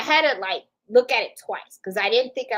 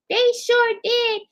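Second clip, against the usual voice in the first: much higher and slow, drawn-out agreement; imitating the lady; 13 semitones higher, 3 dB louder, slower than usual. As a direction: play the chatty older lady. Older lady at the store voiced.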